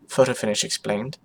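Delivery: ordinary delivery, nothing marked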